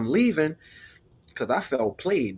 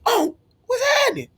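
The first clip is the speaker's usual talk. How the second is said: much higher, fast, squeaky fake-casual "Oh, what's happening?"; caught-off-guard greeting; 3 dB louder, faster than usual. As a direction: play it cool while caught. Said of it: "I'm like".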